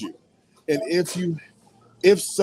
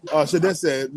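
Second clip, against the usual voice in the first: ordinary talk, nothing marked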